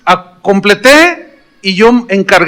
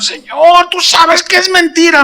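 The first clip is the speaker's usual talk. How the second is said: queja aguda al Señor; protesta exclamada; 12 semitones higher than usual. Retold or imitated. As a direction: reclama en tono muy alto, como quien se defiende. Protesta dolida al Señor, relatada. (protesta exclamada en tono agudo)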